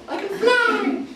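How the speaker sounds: very high, gleeful cry; hammed-up squeal of someone leaping off a roof; mock euphoria. Mimics cured client jumping off building.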